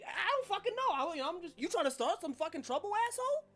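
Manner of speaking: very high, agitated character voice; belligerent trash can. Acted. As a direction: needle them into a fight. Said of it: "trash cans ... are talking".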